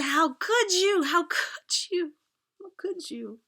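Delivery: high, loud, slow cry that fades with each repeat; anguished accusation. Retold or imitated. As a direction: hurl it through tears, then let it break down. Teen confronts mom, sobbing, betrayed.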